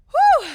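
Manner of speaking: high, loud exhale; loud whew. Real, not acted. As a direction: blow it all out. Exhale after hard set.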